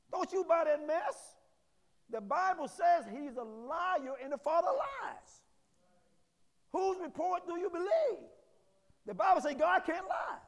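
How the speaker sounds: ordinary talk, nothing marked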